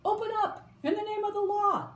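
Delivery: high-pitched official demand; commanding cry. A narrator's reading. Policemen at Mister Muster's door, crying out a command. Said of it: "they cried".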